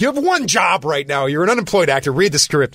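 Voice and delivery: high, insistent imitated telling-off; exasperated scolding. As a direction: scold him as the exasperated friend. Friend scolds the jobless actor.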